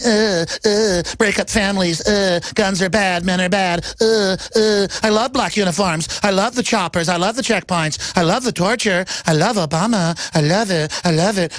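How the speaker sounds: hammed-up effeminate impression; mock effeminate liberal. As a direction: simper each phrase in a mocking sing-song. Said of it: "walking around in an effeminate way".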